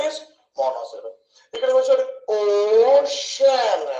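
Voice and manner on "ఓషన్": ఓషన్ slowly split as "ఓ... షన్"; slow syllable-by-syllable ఓషన్, in two beats. Shown as a demonstration. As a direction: say ఓ, pause, then షన్. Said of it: "రెండు సార్లు వచ్చి కాబట్టి".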